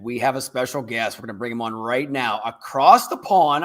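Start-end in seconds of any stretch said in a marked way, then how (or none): 2.4-3.6 slow, loud, stretched-out words; slow, emphatic announcer delivery; drawn-out announcement. Shown as a demonstration. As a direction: draw it out like a guest introduction. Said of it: "I'm gonna say it like that".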